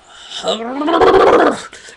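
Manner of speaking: loud high groaning sigh; exasperated loud sigh. Real, not acted. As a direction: let out a huge fed-up sigh.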